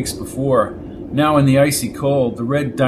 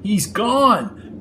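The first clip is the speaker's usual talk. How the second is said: yelled; 8 semitones higher than usual. Acted. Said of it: "yelled Brady over the howl".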